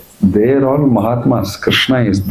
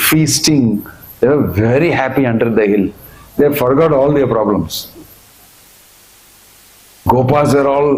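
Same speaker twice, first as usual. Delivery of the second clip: ordinary delivery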